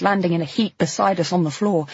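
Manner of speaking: usual speaking voice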